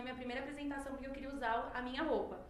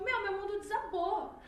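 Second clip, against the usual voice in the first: rising exasperation; 8 semitones higher, 9 dB louder than usual. Retold or imitated. Retelling childhood despair, building.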